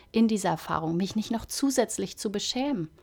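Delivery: ordinary talk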